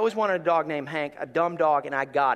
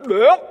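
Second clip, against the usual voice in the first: loud mock gag; retching noise; 6 semitones higher, 13 dB louder than usual. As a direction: imitate the dog about to throw up. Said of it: "he's like".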